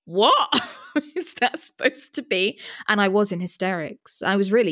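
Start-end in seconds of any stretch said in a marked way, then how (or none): 0.0-2.6 slow, high, laughing "what is that"; a kid cracking up at the screen; incredulous giggling question; laughing disbelief. Retold or imitated. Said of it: "remember going".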